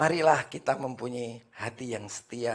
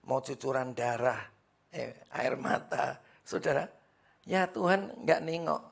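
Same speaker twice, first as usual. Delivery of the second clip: ordinary talk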